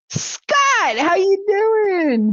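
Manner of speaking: high, slow drawn-out hello; high, slow, stretched-out hello to a viewer; sing-song greeting. Pretend. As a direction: greet him like a flirty charmer.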